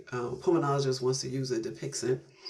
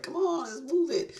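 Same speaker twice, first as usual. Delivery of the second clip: high, put-on hurry-up voice; playful high-pitched let's-go; impatient urging; 13 semitones higher than usual. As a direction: chivvy them along in a squeaky comic pitch.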